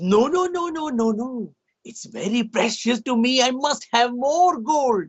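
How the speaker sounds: a lightly voiced greedy king, drawn out; a hint of a gold-mad king pleading. Storyteller voicing the king, insisting he wants gold.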